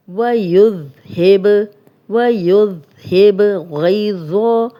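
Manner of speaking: ویذہب with a soft ذ, slow and loud; slow tajweed drill; soft dhal. Shown as a demonstration. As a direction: keep the ذ in ویذہب soft, not z. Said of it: "ذال کو نرم پڑھیں گے".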